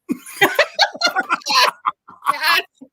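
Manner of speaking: big rising laugh; loud laugh. Real, not acted. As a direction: burst out laughing. Laughing at acronym.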